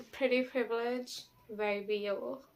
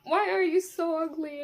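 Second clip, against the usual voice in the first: self-insult; 6 semitones higher, 6 dB louder than usual. Retold or imitated. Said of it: "cursing myself out. I'd be like".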